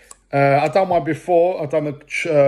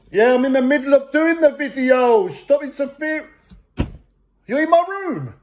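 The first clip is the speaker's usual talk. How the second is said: higher, exasperated telling-off; irritated shouting at mum; 7 semitones higher than usual. Real, not acted. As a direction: snap back at mum to stay out. Arguing with mum mid-video.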